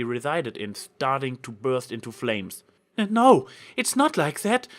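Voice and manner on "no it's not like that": high, loud desperate denial; frantic protest. A narrator's reading. Trixie denying the accusation, high frantic protest. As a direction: cry out in panic to defend yourself. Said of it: "Trixie protested".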